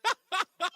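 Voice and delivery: high burst of laughter; caller's laugh. Real, not acted. Caller laughing with the host, loud laugh.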